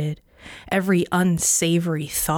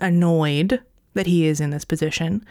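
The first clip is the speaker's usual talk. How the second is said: usual speaking voice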